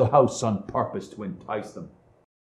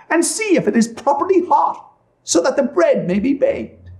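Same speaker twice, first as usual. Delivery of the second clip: high-pitched, instructing witch; scheming witch; 13 semitones higher than usual. Acted. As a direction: coax her toward danger in a high witch voice.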